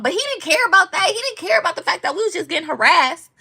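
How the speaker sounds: high, quick, aggrieved protest; indignant complaint. Real, not acted. Driver complaining officer ignored harassment, indignant.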